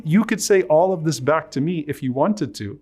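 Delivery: ordinary talk, nothing marked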